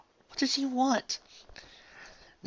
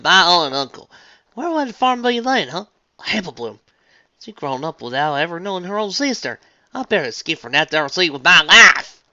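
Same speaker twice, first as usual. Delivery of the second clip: put-on Southern farm girl voice; 6 semitones higher, 10 dB louder than usual. A narrator's reading. Applejack retelling, country voice.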